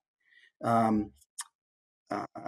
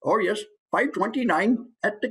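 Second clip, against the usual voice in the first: higher-pitched Indian accent from back in the throat; lilting, high Indian accent, placed back; 7 semitones higher than usual. Shown as a demonstration. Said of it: "Indian is also kind of back".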